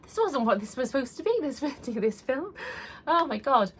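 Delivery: real tears breaking through, voice pushed high; teary laugh while fighting to keep talking; choked up, half laughing, half crying as the memory hits; getting emotional. Real, not acted. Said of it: "sorry, I'm getting emotional".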